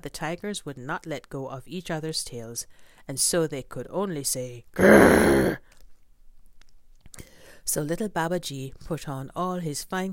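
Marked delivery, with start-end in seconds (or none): 4.7-5.6 loud, low rumbling growl; low tiger growl. Acted. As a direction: growl through clenched jaws. Tigers answer.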